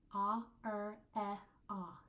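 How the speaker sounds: "Ah, er, eh, ah" as separate held vowels; isolated vowel sounds. Shown as a demonstration. Teacher pointing out vowel sounds, isolated and distinct. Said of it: "Look at your vowel sounds".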